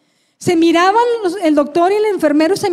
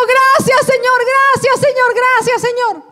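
grito de gratitud; 7 semitones higher than usual. Retold or imitated. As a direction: shout thanks up to the Lord. Repeats thanks with raised hand. (gritando gracias al Señor, con voz aguda)

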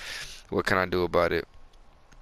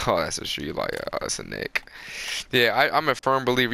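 usual speaking voice

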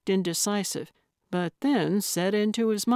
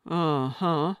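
low, skeptical acknowledgement; a doubting uh-huh, pitched a little down; 3 semitones lower than usual. A narrator's reading. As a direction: show you don't buy it. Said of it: "the skepticism in his voice".

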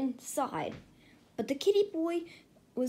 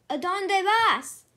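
louder call, higher; 8 semitones higher, 8 dB louder, slower than usual. A narrator's reading. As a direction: hail the boat from the bank. Calling out to passing friends.

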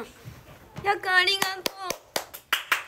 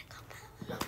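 1 whisper; 20 dB quieter than usual. Real, not acted. Greeting viewers again softly.

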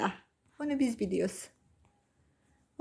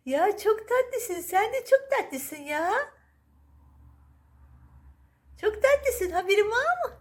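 high, cooing pet-talk voice; baby talk to cat; 11 semitones higher than usual. Acted. Owner cooing to her cat, high baby talk. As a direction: gush over the cat like a baby.

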